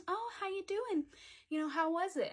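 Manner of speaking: mom's chirpy question. Retold or imitated. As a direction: lift the voice high, mimicking mom's greeting. Voices mom greeting her at home.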